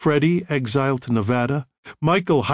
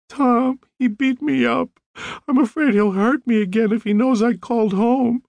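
much higher, tearful plea; pleading on the edge of tears, pitched up; weepy; 11 semitones higher than usual. A narrator's reading. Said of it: "Connie's voice was weepy".

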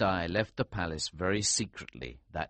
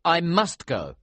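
arguing; 9 semitones higher, 7 dB louder than usual. A narrator's reading. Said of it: "I argued".

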